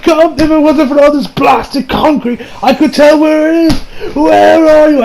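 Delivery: high-pitched mock desperation; acted frantic searching. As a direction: call out as if hunting blindly for someone.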